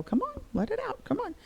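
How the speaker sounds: high, soft, tender urging; small, hushed sing-song encouragement; gentle coaxing. Retold or imitated. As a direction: coax it out of a child very softly. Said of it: "You have to coax it up".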